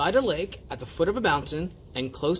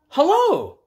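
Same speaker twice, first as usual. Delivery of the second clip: much higher, bright louder greeting; cheery hello; 7 dB louder than usual. Acted. Mister Happy greets stranger, high and cheerful.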